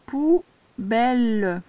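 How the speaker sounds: very slow, each syllable drawn out. Shown as a demonstration. Teacher reading a new word to a learner, syllable by syllable. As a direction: stretch both syllables for a beginning reader.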